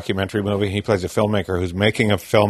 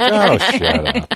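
character voice; 10 semitones higher, much slower than usual. Retold or imitated. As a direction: play a smug cinematographer's stock guy, slow and pushy. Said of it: "That's your sibling's voice".

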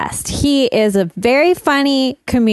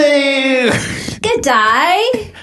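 mock Antipodean accent; 3 semitones higher than usual. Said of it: "Kiwi accent".

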